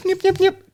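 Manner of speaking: high beeping nip sounds; squeaky electronic nips; computer beeps. Retold or imitated.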